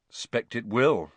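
slow and heavy, a touch of gloom; understated weary reply. Acted. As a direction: let the words sink, resigned. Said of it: "said Harry heavily".

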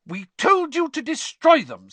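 high, loud scolding mother; furious outburst at disobedient children. A narrator's reading. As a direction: scold them at full volume, pitched high. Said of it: "said Missus Weasley furiously".

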